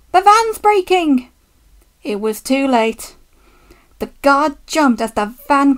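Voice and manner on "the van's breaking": loud, high alarm; anxious high-pitched shout; yelled. A narrator's reading. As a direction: yell an urgent warning. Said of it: "he yelled to Douglas".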